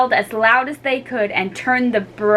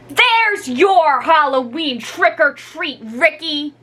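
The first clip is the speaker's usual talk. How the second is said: louder, slow taunting call; 7 semitones higher, 3 dB louder, slower than usual. Acted. Molly calls to Ricky from window, taunting. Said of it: "Molly called out the window".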